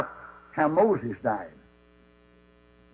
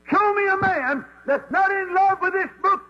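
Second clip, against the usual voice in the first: preaching shout. Real, not acted. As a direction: throw it to the back pew. Preaching climax.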